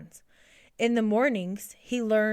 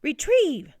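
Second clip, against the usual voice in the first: high, raised fetch command; calling a command; 7 semitones higher, 6 dB louder than usual. A narrator's reading. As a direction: send the pet off with a loud call. Lewis commands his pet to fetch.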